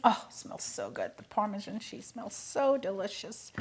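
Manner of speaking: soft low hushed delight; savoring a smell. Real, not acted. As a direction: breathe in the aroma softly. Smelling the parmesan.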